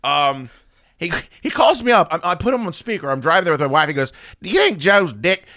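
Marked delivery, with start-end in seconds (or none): 4.4-5.5 higher, louder mock voice of a friend phoning; imitated eager caller. Host imitates friend calling from the store, mock eager voice. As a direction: put on the friend's earnest, raised phone voice. Mimicking friend's phone call about cup.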